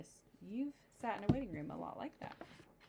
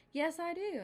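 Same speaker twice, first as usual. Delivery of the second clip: high mama voice; 11 semitones higher, 4 dB louder than usual. Acted. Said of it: "answered Mama".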